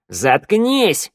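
high, sharp shout; barked command. A narrator's reading. Shnyryala cuts off the pleading Vorona, barking. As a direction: bark to silence him. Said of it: "рявкнула Шныряла".

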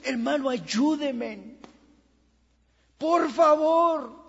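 slow, begging, rising high and loud on por favor; desperate plea. Retold or imitated. Believer begging his brothers for prayer: slow, desperate plea. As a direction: drag it out like you're pleading for rescue. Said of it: "se reúne con otros".

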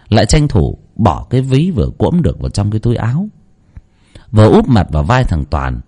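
usual speaking voice

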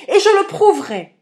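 high, furious yell; indignant howl at full force. A narrator's reading. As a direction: shout a defiant vow. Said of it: "hurlai-je".

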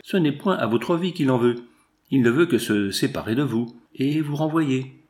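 plain reading voice

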